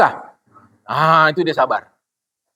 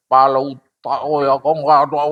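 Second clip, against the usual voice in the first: lower, gruff mock outburst at the wife; mock angry rant; 6 semitones lower than usual. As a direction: play the husband ranting angrily. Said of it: "itu bukan sabar, itu marah". (suami marah-marah mengejek istri)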